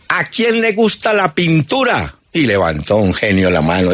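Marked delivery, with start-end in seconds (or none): 0.1-2.2 mock sergeant. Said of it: "llegó ese sargento y dijo".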